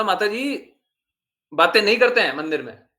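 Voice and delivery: stern loud hush-up of a chatting grandmother; loud scolding. Retold or imitated. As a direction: tell her off loudly in the temple. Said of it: "जोर से बोला".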